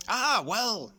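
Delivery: put-on a nosy old bird bursting with news; chatty old crow. A narrator's reading. Mister Crow calling to Eric from the gate, loud rustic crow.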